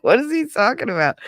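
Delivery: high, baffled disbelief; a hammed-up stunned kid. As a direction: squeak out confused shock, far up in pitch. Shocked at hearing her name.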